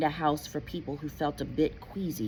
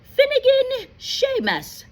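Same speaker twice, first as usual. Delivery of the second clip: loud roll call; calling out a name; 8 dB louder, slower than usual. Acted. McGonagall calls the next student up, loudly. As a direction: call the name across the hall.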